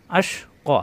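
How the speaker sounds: আশ, ক with the ক stretched one alif at the pause; recitation demo. Shown as a demonstration. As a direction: draw the final ক out one alif as you stop. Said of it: "এক আলিফ টানতে হবে".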